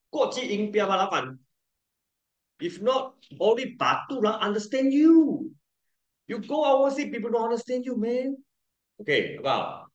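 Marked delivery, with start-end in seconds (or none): 2.6-8.4 higher playful scolding; teasing. Retold or imitated. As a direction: tease the class about accent. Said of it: "teasing about local accent".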